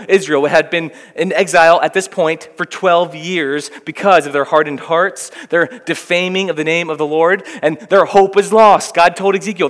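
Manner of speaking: normal talking voice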